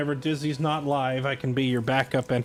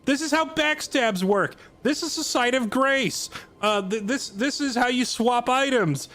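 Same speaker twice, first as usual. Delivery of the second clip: higher-pitched game tutorial guide; 8 semitones higher than usual. Retold or imitated. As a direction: rattle off the tutorial steps. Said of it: "the guy is like".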